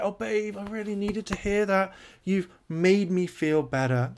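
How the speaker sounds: mock swooning woman. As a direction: melt at the compliment, overdone. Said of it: "She's like".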